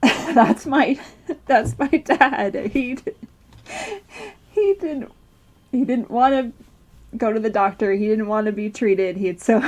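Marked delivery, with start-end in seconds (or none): 0.0-6.5 real, rueful laugh breaking up the words; halting words between bursts of laughter. Real, not acted. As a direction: laugh fondly through a painful memory. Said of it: "but I'm laughing because it's".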